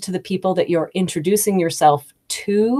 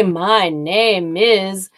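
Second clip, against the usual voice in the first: students' stiff intro; much slower than usual. Retold or imitated. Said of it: "Anyways, they would say".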